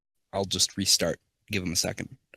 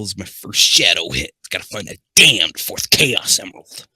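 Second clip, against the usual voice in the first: trying on a Shadow voice, punched louder on key words; 8 dB louder than usual. Shown as a demonstration. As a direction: lean hard into DAMNED and the emerald line. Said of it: "Gotta get that voice on".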